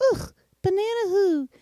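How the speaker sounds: high, slow mock exasperation; groan, then whine it high as a fed-up listener; irritated groan. Listener stuck in a repeated banana joke, mock irritated. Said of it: "they're getting really irritated with you".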